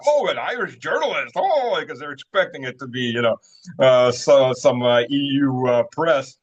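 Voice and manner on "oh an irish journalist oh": high, hammed-up gush of surprise; mock the delighted protesters; squealing, mocking excitement. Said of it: "and they're like".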